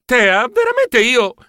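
high, slow startled protest; surprised protest. Acted. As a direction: try to object but stumble. Cut off by sister.

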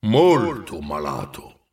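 low, drawn-out giant voice; grave ghost. A narrator's reading. Ghost confirming the boy's illness, low and grave. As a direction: confirm it slowly and darkly.

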